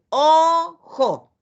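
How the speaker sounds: "Ojo" dicho fuerte y claro, enseñando; model word said aloud. Shown as a demonstration. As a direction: say "Ojo" loud and clear for kids. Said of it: "Vamos a emitir el sonido". ("Ojo" pronunciado fuerte y claro)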